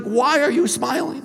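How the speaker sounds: high-pitched, rising bewildered question; retold tearful mom. Mom to her oddly grinning bullied son, retold tearful. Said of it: "she is just bawling".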